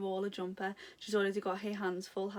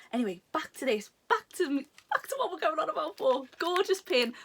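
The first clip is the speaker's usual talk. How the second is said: from a steady restart up to a high, loud gush; building excitement; 8 semitones higher, 5 dB louder than usual. Real, not acted. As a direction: back on topic, growing louder and higher. Building back to the pin.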